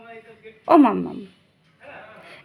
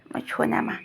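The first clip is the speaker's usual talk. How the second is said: whispering; 3 semitones lower, 10 dB quieter than usual. Real, not acted. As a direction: whisper it tenderly. Whispering to the child.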